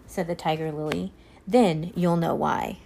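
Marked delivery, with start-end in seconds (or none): 1.5-2.8 slow knowing reply. Acted. Tiger Lily knowing.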